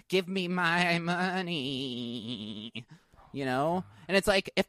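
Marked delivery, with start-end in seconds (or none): 0.1-2.7 long drawn-out, higher-pitched whine; mock demanding whine. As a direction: stretch every word into a pleading wail. Mock plea for a refund.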